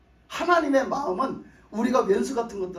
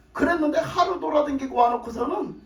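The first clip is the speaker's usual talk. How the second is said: normal talking voice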